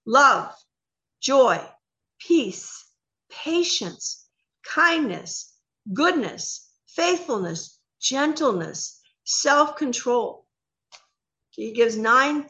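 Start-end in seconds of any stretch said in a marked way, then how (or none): 0.1-10.5 slow deliberate list. Shown as a demonstration. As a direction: savor every word with a pause between. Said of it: "he gives several words here".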